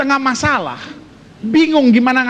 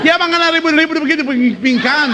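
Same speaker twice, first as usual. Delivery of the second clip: put-on old mother; 3 semitones higher than usual. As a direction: call the couple to stop fighting. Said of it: "dia pe mama datang".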